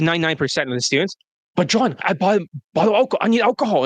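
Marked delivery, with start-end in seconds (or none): 1.6-3.8 higher, whiny plea for a drink; lightly mocked Indian student begging; pleading student. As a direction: beg John for alcohol like a desperate student. Mocking a student begging coach.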